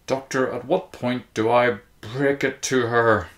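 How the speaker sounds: trembling. A narrator's reading. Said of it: "She was trembling".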